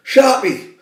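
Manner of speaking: high mock rant; a hint of a furious Ferguson tearing into players; imitating a raging manager. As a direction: rant like a furious gaffer, half-hammed. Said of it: "He's fucking raging".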